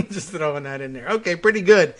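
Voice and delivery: ordinary delivery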